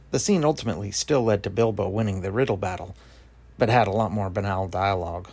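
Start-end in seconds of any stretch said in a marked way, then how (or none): none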